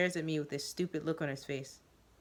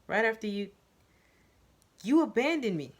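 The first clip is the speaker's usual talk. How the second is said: louder and higher, jabbing each word; aggressive accusation; 6 semitones higher, 6 dB louder than usual. Acted. Narrator accusing Joseph of abandoning him, angrily. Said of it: "I aggressively point".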